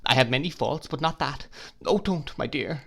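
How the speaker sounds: high, upset pleading; crying plea. Acted. Upset wife pleading with her husband. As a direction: beg him not to accuse her. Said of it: "cried my mother".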